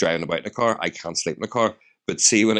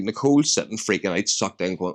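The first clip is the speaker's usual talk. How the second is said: ordinary talk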